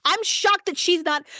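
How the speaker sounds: usual speaking voice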